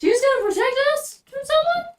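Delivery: high, loud mock-panicked questions; acted alarm. Adventurer reacting to a threatening note, in acted alarm. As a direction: cry out in hammed-up alarm.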